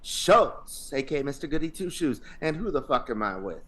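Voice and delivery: hype podcast intro. Acted. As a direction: pump up the crowd like a host.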